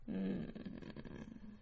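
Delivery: low, very soft "mm" of relish; long, hushed murmur of pleasure; savouring hum. A narrator's reading. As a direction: hum quietly, savouring the taste. Mother savours taste of the blade.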